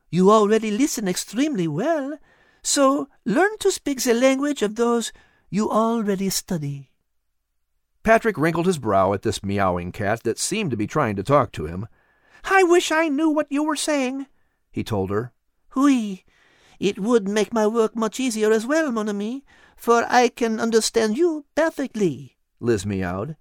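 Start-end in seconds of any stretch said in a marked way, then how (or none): 0.1-6.9 put-on high, lilting French-accented cat voice; a wise cat coaxing gently; cat Liz. Cat Liz coaxing Patrick in a high French-tinged voice. 12.4-14.3 put-on high, eager boy voice; a curious boy talking to a cat; young boy Patrick. Boy Patrick wondering aloud to the cat, high and eager. 15.8-22.4 put-on high, lilting French-accented cat voice; a wise cat coaxing gently; cat Liz. Cat Liz coaxing Patrick in a high French-tinged voice.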